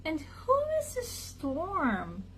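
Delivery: high, slow, soft cat voice; meowed. A narrator's reading. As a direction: purr the question out slowly and high. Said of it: "she meowed".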